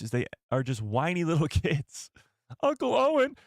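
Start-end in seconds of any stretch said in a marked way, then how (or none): 2.6-3.3 high, loud exclamation; excited outburst. Real, not acted. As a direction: blurt it out high. Sudden loud pitch jump.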